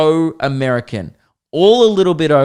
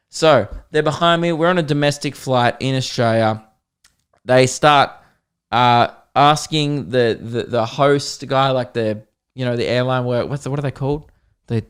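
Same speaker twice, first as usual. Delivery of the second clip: ordinary talk, nothing marked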